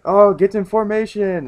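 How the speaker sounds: high, loud mock command; calling out. Fishmen pirates flying around, calling out orders.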